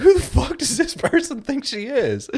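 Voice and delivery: indignant mock outburst. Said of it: "I was like".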